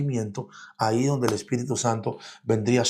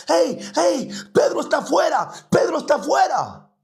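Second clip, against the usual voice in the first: much higher, urgent excited cry; excited announcement; 13 semitones higher than usual. Acted. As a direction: burst in breathless with big news. Rode runs telling the praying group. (muchacha anuncia emocionada a los que oran, voz mucho más aguda)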